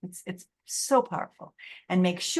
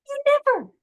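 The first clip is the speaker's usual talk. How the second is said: high, louder mock accusation; mock accusing; 7 dB louder than usual. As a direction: throw the blame like a raging partner. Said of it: "say things like".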